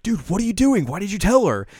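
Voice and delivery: hammed-up exasperated hiss-free yell; annoyed Azusa. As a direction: scold her friend for spilling the secret. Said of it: "And Azusa's like".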